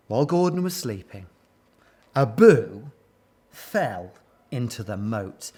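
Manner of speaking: ordinary delivery, nothing marked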